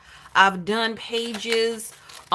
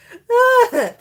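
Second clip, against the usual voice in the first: high, louder burst of laughter; cracking up at the chat; 12 semitones higher, 8 dB louder than usual. Real, not acted. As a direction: let a big laugh out. Laughs after "sister.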